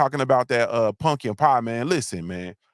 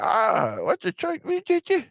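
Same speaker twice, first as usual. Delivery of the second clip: squeaky, much higher disgusted gasp of surprise; shocked pie face; 9 semitones higher than usual. Retold or imitated. Biting into a fake pie, shrieking high in shock. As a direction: voice jumps way up in disbelief at the taste. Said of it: "You start talking like this".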